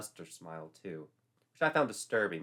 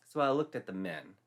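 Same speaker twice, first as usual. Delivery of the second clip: usual speaking voice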